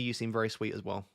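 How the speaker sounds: normal talking voice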